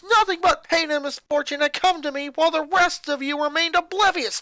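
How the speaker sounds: put-on high, loud furious boy shouting at family; a boy exploding at his mother; angry boy ranting. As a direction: snap back at Mom, furious. Lincoln's angry rant.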